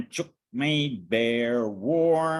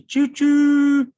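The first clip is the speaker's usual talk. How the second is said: high, loud choo choo like a train whistle; 14 semitones higher, 11 dB louder than usual. Acted. Reading a picture book to kids, train whistle choo choo.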